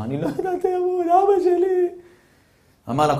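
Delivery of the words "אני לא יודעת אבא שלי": put-on high, wavering sob-like girl's voice; much higher, crying young woman. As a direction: break into tears over her father. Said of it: "היא בוכה לו".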